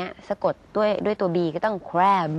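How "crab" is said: "crab" drawn out to a voiced B; long voiced ending. Shown as a demonstration. Teacher shows the rule for final B, long voiced ending. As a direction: stretch "crab" and sound the final B. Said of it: "ต้องลากเสียงยาวนะคะ".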